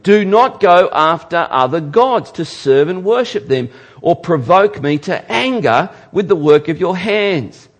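loud, high declaiming of scripture; scripture read with force. Acted. Pastor reading God's warning to the congregation, loud and declaiming. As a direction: declaim God's command from the pulpit.

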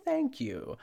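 hammed-up host gratitude; imitating Ina. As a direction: chirp it up high like a TV host thanking fans. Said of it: "And Ina's like".

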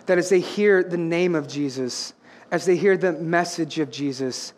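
low, softened preacher's voice; measured, reverent phrases after the climax; hushed solemn preaching. Real, not acted. Preacher drops to hush after climax.

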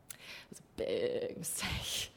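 soft, low whisper of "big mistake"; whispered aside. Real, not acted. Narrator confiding to audience, hushed whispered aside. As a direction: drop to a hushed confession.

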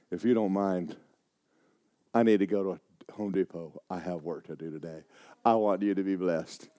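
low, soft, steady answer; calmly defuse the bully; soft, gentle firmness; calm reply. Retold or imitated.

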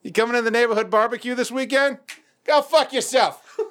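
high-pitched chummy call; mock cheery neighbor. Neighbors arranging a weekend barbecue, high hammed-up cheery voice. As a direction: sing out the insult as a fond invitation.

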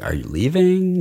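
"living" as "leeving", high questioning rise; Spanish-accented pronunciation. Shown as a demonstration. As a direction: ask it high, with a long ee in "leaving". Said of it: "they might say".

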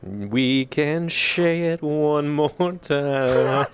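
very slow playful lyric; drawn-out pun. Pretend. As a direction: stretch it out teasingly.

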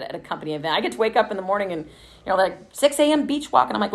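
usual speaking voice

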